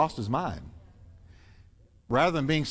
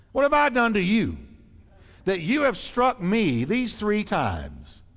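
donkey pleading; 6 semitones higher than usual. Acted. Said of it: "she said to Balaam".

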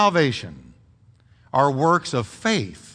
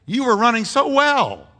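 raised, pleading rebuke to a straying friend; pretend confrontation; 5 semitones higher than usual. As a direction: call out the friend who quit, pitch up. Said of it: "I could easily say".

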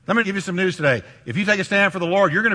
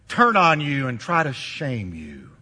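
ordinary talk, nothing marked

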